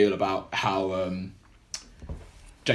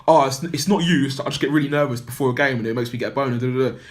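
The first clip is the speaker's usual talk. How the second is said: higher, louder fidgety nervous excuse; anxious; 5 semitones higher, 6 dB louder than usual. Retold or imitated. Player nervously excusing himself to his teammate before a game, anxious. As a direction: get flustered, rushing out the excuse. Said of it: "and really anxious".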